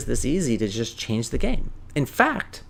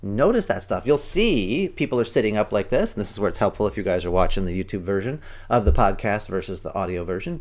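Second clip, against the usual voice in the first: ordinary talk, nothing marked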